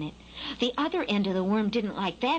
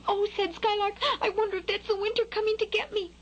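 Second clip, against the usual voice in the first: high, hurried fledgling voice; gasping little bird, alarmed; frightened Skylark; 11 semitones higher than usual. A narrator's reading. Skylark hearing booms he thinks are winter, high and alarmed.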